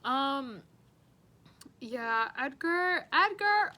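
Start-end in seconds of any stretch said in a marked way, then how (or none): 2.4-3.7 the name twice, rising and louder; excited louder call of his name. Real, not acted. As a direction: call Edgar as if across the room. Calling out the next student.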